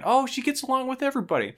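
a light mock of the dad's answer; chirpy dad. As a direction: toss it off like a clueless proud dad. Dad answers mom's question.

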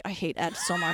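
low, very soft mock grumble; privately hateful. Host voicing what Keith secretly feels about Ed, low muttered loathing. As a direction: imitate secret loathing under your breath. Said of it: "you can feel Keith like".

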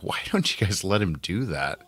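usual speaking voice

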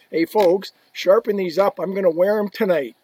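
loud, breezy order from a star player; loud, pleased demand to the equipment manager; loud cocky demand. Retold or imitated. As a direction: call out the order like the skates are already yours. Messier demanding skates from equipment manager.

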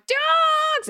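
high, loud scream of one drawn word; screamed shout. Retold or imitated. Comedian re-enacting the yell that cost her voice, screamed. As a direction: scream it out at full stretch. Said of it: "And I screamed it".

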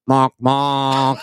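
low, drawn-out double honk; truck horn imitation. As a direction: honk twice like a passing truck. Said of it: "I hear a truck go".

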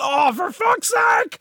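very high, frantic outburst; exasperated yell. Real, not acted. Player reacts to Trent Alexander-Arnold, exasperated high yell. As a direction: let the name climb into an anguished shout. Cries out at match event.

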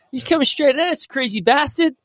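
high, frantic yell; panicked quote. Retold or imitated. Retelling the car scene, high and frantic. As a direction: shriek it like the car is coming at you. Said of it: "Pushes the car off the hill".

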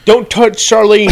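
loud, high indignant warning; protective brother. Acted. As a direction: snap it out like a jealous boyfriend. Brother protects his doll.

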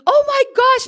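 squealed oh my gosh, very high; a hammed-up shocked exclamation; shocked gasp. Girlfriend reacting to the cheater, hammed-up shock. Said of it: "my girlfriend goes".

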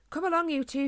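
put-on mother ordering her two children along. A narrator's reading. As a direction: round the children up briskly. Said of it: "she ordered".

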